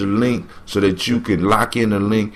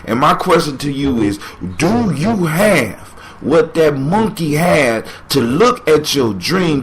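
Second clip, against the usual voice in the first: normal talking voice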